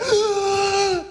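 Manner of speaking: long, high straining gasp; deep gasp. Acted. Jesus lifting himself on the nails, straining gasp. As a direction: haul in a long, pained breath. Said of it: "took a deep breath".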